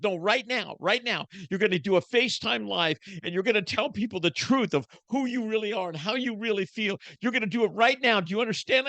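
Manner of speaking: high, firm wife imitation; insistent wife. Wife insisting husband go live now, high firm voice. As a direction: press him like a wife at the end of her rope. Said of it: "She goes".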